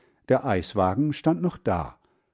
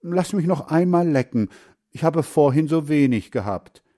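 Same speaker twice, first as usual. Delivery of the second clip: put-on boy begging in a higher voice; pleading; 5 semitones higher, 5 dB louder than usual. A narrator's reading. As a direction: coax for one more taste. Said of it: "bat Hans Frieder". (bittend, etwas höhere Stimme)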